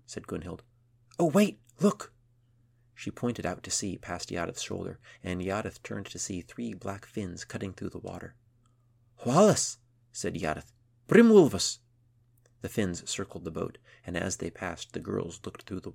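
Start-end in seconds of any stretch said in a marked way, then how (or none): 1.2-2.1 excited. Acted. Spotting fins at sea. 9.3-9.8 excited. Acted. Greeting orcas excitedly. 11.1-11.8 excited. Acted. Calling out orca name.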